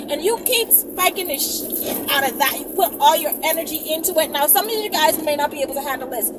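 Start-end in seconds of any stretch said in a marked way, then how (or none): none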